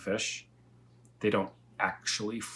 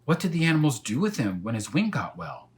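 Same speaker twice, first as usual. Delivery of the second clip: louder, higher eager question; eager boy; 5 semitones higher, 6 dB louder than usual. Acted. Elmer pressing the cat, louder and higher. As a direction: press for more.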